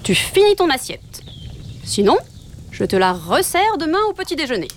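a bossy father wolf, lightly voiced; stern father wolf. A narrator's reading. Father wolf ordering his son to finish his plate, mildly stern. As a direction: lay down the law over the plate.

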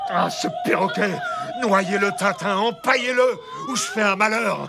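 high, strained raging; furious outburst. Acted. Captain demanding Tintin get rid of the parrot, enraged. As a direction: rant at the end of your tether.

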